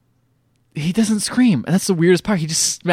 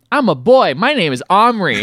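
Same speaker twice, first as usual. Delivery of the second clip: put-on louder, higher goofy kid voice; mock eager boy; 6 semitones higher, 5 dB louder than usual. Boy introduces himself to the little man, mock eager kid voice. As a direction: announce it loud and high like a clueless kid. Boy answers man who thinks him god.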